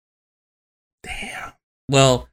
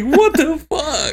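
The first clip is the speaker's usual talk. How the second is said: high, drawn-out, stunned yell; shocked what the fuck; slower than usual. Retold or imitated. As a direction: let the disbelief stretch out. Retold shocked reaction.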